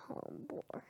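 real hushed whisper. Real, not acted. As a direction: trail off into a whisper. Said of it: "I'm whispering, like, sometimes".

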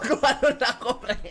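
ordinary delivery

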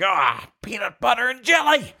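high-pitched wail of despair; anguished outburst. Acted. Polish worker opens the dreaded lunch, wailing. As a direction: wail it high, at the end of your rope.

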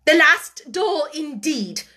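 loud, high, slow retort; scornful echo. A narrator's reading. Headmistress mocks the child's words about the doll, scornfully. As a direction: throw the words back scornfully.

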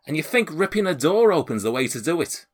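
high, incredulous scolding in a put-on accent; aggrieved, high-pitched character voice; indignant homeowner. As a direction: challenge the intruder, affronted. Man scolds the intruder.

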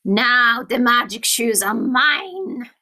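slow, gloating witch voice; storybook villain witch; cackling wicked witch. Acted. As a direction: draw it out like a triumphant wicked witch. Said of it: "Cackled the Wicked Witch".

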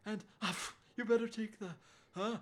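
high voice interrupted by explosive fake sneezes; acted, gasping allergy attack; mock sneezing fit. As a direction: sneeze loudly between broken phrases. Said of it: "breaking out in sneezing fits".

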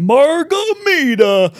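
put-on long, high drunken roar of margarita; drunken Goliath bellow. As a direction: bellow it like a dumb drunk giant. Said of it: "Goliath wandering around going".

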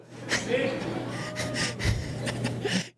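carcajada aguda y larga; reír fuerte, con voz aguda; risa aguda. Real, not acted. Risa del pastor. (el pastor ríe de su propio chiste, risa aguda)

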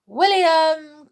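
high, loud drawn call. A narrator's reading. Tom calling down the mouse hole, calling out. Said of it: "called Tom".

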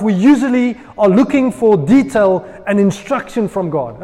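ordinary talk

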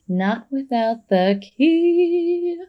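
eerie, high, very slow delivery; mock-ominous singsong prophecy; Drusilla. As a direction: stretch the last word out long and high. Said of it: "tells Spike that this guy can't help us".

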